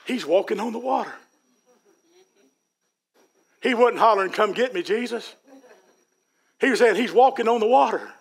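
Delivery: excited inner voice, rising in pitch as it builds; Peter's inner voice. Retold or imitated. Preacher voicing Peter's thoughts, rising and eager. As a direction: let Peter's thought lift with wonder. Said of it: "And he thought to himself".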